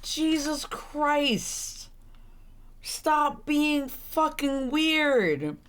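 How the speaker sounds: high, very slow appalled drawl; disgusted. Real, not acted. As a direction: stretch every word, repulsed. Disgusted at the creepy character.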